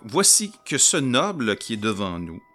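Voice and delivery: normal talking voice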